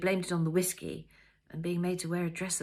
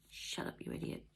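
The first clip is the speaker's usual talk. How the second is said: much softer, almost breathed; soft tearful tease; 9 dB quieter than usual. A narrator's reading. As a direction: hush him fondly through tears.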